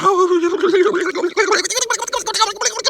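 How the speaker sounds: imitated hurried shuffle noise. Mimicking fast walking noises.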